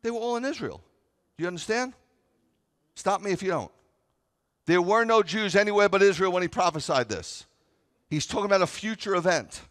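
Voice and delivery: high, loud impassioned preaching; fiery sermon climax; preaching at full force. Acted. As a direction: drive the point home to the congregation.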